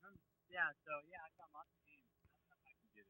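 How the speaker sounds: faint voice far from the phone; too far from the phone; phone held away. Real, not acted. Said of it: "Can't hear you very well".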